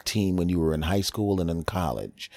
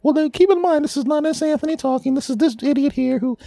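squeaky high voice; 5 dB louder than usual. Acted.

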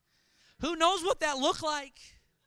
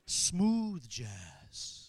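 low, silky radio-announcer "Smooth jazz."; 8 semitones lower than usual. Pretend. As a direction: purr "Smooth jazz." like a late-night DJ.

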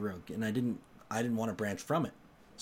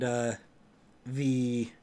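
ordinary delivery

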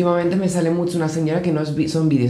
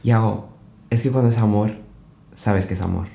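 mock profound advice; 6 semitones lower, 4 dB quieter than usual. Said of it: "Y me dice".

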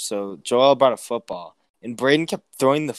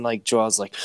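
usual speaking voice

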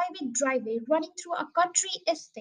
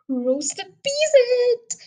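high, loud, very slow proclamation; cried out; 10 semitones higher, 7 dB louder, much slower than usual. A narrator's reading. Father proclaims to the moon, loud and slow. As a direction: trumpet it to the whole countryside. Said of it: "he cried out".

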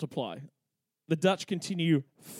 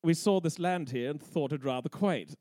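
lightly voiced British colonist accent, low start; a hint of a haughty British coloniser; posh British accent; 3 semitones lower than usual. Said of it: "they stroll on in and say".

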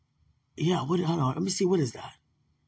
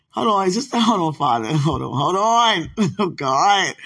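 laughing; 6 dB louder than usual. Real, not acted. Overwhelmed, laughing while praying.

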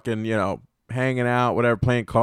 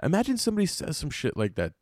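voice breaking; 8 dB quieter, faster than usual. Real, not acted. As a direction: let the voice break in disbelief. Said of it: "it sounded like my, my voice was breaking".

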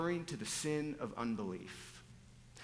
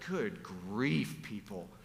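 incredulous, pitch leaping up on the questions; exasperated; 3 semitones higher than usual. Acted. Preacher voices Jesus fed up with the people, exasperated. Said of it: "Can't you just see him like".

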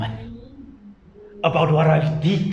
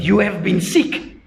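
raised, ringing sermon voice; full-force sermon delivery; fiery preaching; 8 semitones higher, 4 dB louder than usual. Acted. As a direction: preach it out to the congregation.

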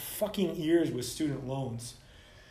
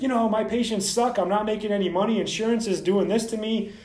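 mock whiny doctor, higher and louder; whiny complaining doctor; 5 semitones higher, 5 dB louder than usual. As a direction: ham up a self-pitying doctor griping. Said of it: "are bitching and are like".

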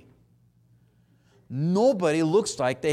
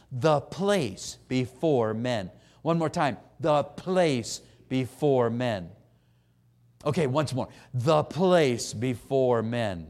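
The place before men, drawn out slowly word by word; slow call and response; 4 semitones lower, slower than usual. Shown as a demonstration. Preacher leading congregation to repeat the place before men slowly. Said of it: "Would you say that with me?".

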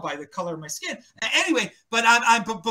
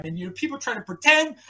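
ordinary talk

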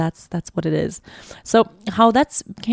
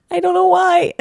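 much higher, tearful laugh; laughing through tears; 12 semitones higher than usual. Real, not acted. Unsure why she is crying, laughing through tears. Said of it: "I am really emotional".

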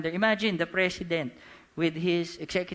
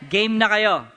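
loud, higher-pitched rallying call; 5 semitones higher, 10 dB louder than usual. Real, not acted. Preacher rallying the congregation before his key line, loud call. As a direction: fire up the crowd loudly.

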